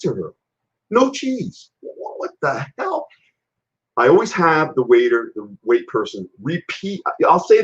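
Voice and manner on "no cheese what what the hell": high, disbelieving outburst; stunned that the cheese is missing; surprised. Real, not acted. Finds no cheese on bread.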